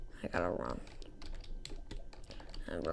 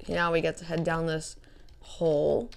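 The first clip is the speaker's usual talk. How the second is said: usual speaking voice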